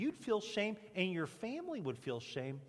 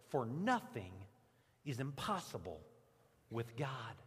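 solemn scripture reading; 8 semitones lower, 4 dB quieter than usual. Acted. Quoting scripture slowly and lower.

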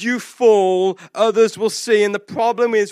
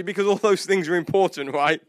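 usual speaking voice

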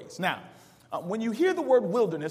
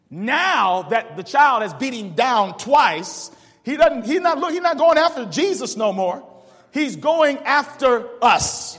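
slow, louder preacher's emphasis; preaching; 6 semitones higher, 8 dB louder, slower than usual. Acted. Pastor warning the church, slow and loud. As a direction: draw it out like a warning.

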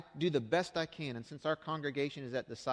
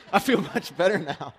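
louder, with a real laugh through it; 12 dB louder than usual. Real, not acted. Pastor to congregation after his rant, laughing. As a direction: laugh as you admit the outburst felt good.

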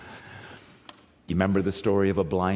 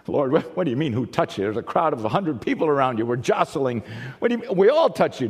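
raised, exasperated disbelief; incredulous protest; 5 semitones higher, 5 dB louder than usual. Retold or imitated. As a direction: protest as if it's obvious.

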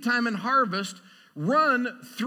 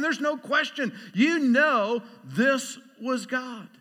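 ordinary talk, nothing marked

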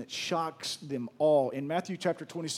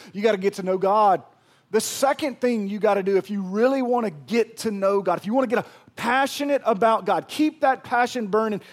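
loud, high preacher's build; fiery preaching; 6 semitones higher, 8 dB louder than usual. Acted. Pastor urging the church to pray, fiery preaching. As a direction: drive the point home from the pulpit.

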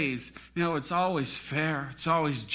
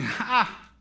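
short, scornful mock "Ha"; lightly acted jeer; mocking laugh; 5 semitones higher than usual. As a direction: imitate a scoffer's laugh. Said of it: "laughing, saying".

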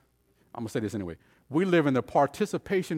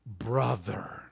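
"brother" set apart and low; weighty, separate "brother"; stress on brother; 4 semitones lower, 6 dB quieter than usual. Shown as a demonstration. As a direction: land "brother" alone, low and soft. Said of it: "here's the emphasis".

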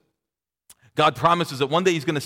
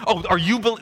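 condescending sneer; 6 semitones higher, 5 dB louder than usual. Retold or imitated.

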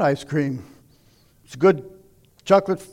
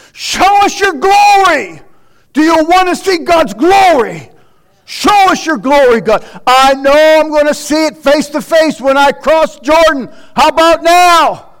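full-force preaching; 9 semitones higher, 12 dB louder than usual. Acted. Preacher begs God to show his glory, loud and high. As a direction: lift the whole church, pleading for glory.

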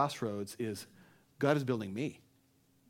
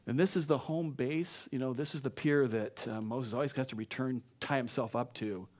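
ordinary talk, nothing marked

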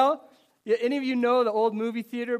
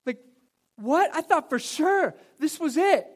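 retold distress; 7 semitones higher, 4 dB louder than usual.

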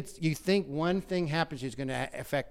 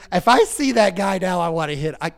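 louder, higher flash of anger; angry outburst; 5 semitones higher, 10 dB louder than usual. Real, not acted. Pastor fumes about the abusive preacher, loud. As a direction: let the anger burst out. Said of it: "you wanna get me mad".

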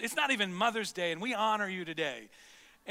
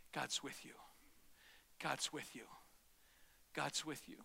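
soft; 6 semitones lower, 13 dB quieter than usual. Real, not acted. Pastor encourages the congregation.

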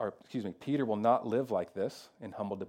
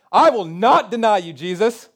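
much higher and louder, all-out declaration; passionate vow; 10 semitones higher, 15 dB louder than usual. Retold or imitated. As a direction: shout the promise with passion. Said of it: "by saying something really passionately".

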